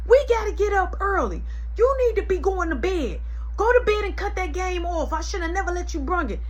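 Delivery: high-pitched mock mom lecture; mom scolding. Mom telling son to go to bed, high pitched. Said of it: "She say".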